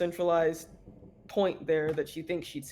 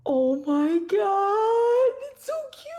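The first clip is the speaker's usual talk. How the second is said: gushing squeal; 5 dB louder, slower than usual. Real, not acted. Player gushing over cute robot.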